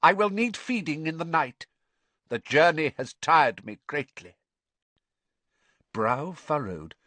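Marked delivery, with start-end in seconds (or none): 0.0-4.4 high cold villain voice; cold and weary, high-pitched; icy cold. A narrator's reading. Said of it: "strangely high-pitched and cold".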